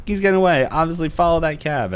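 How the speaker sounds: acting out a call to give chase; urgent retold order.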